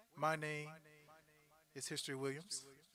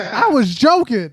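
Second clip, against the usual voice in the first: loud laughing; 7 semitones higher, 27 dB louder, slower than usual. Real, not acted. Laughing apology after guest left.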